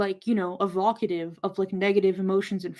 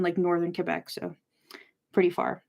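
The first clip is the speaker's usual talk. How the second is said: usual speaking voice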